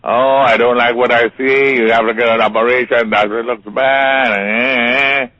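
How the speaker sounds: high, loud grim doctor giving bad news; mock ominous doctor voice; gloomy doctor. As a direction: play the doomsayer doctor. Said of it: "the doctor has a way of saying".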